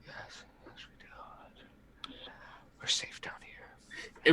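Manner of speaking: hushed, voiceless insistence; frightened whisper. Acted. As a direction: whisper it to reassure yourself. Shaken character insists they are safe.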